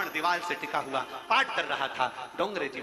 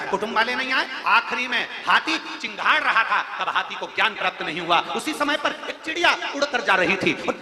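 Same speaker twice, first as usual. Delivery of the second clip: loud, higher fervent sermon voice; full-force sermon, raised high and loud; preaching at full force; 6 semitones higher, 8 dB louder than usual. Acted. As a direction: lift it up and proclaim the devotion. Elephant parable preached loud.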